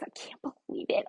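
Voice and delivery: fast, hushed whisper of disbelief; stunned whisper. Real, not acted. Vlogger recapping the show, fast stunned whisper. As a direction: rush it under your breath, aghast. Shocked reaction to reality show scene.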